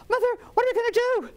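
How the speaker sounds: high, rapid acted alarm; panicked girl. Girl asks mother as the flood rises, fast high panic.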